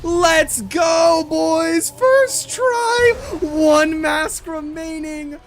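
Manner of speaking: hyped victory shout; frat bro. Real, not acted. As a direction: yell it out, totally pumped. Boss beaten first try, shouting.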